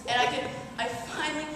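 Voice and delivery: normal talking voice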